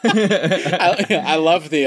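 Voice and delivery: high, loud burst of laughing; words breaking through a real laugh; real laughter. Real, not acted. Cracking up at cohost's demon impression, laughing loudly.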